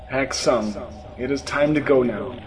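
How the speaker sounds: low, calm guiding voice; solemn Jesus. A narrator's reading. Jesus leading the narrator out, solemn and calm.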